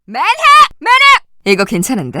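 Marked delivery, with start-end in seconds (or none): 0.8-1.2 high, loud cry for help; urgent call. Acted. Wounded citizen calling for a medic, urgent cry.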